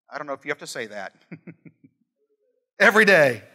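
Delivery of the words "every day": ordinary talk, nothing marked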